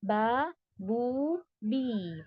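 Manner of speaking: बा बू बी each held two counts, slowly; बा बू बी drawn out; two-count vowels. Shown as a demonstration. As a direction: stretch बा बू बी evenly. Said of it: "उसको हम टू काउंट से खींचते हैं".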